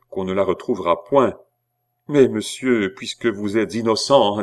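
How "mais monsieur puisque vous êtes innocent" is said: ordinary delivery, nothing marked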